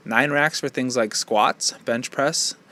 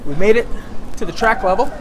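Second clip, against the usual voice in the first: higher, louder, triumphant arrival; excited cheer; 7 semitones higher, 4 dB louder than usual. Real, not acted. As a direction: cheer like you just made it. Cheering on reaching track level.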